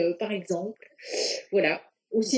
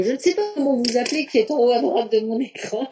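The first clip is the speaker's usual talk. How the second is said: normal talking voice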